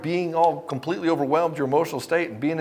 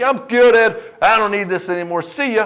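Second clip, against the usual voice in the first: much higher, louder carefree brush-off; breezy dismissive guy; 7 semitones higher, 7 dB louder than usual. Retold or imitated. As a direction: wave the coach off cheerfully. Client quitting the program breezily.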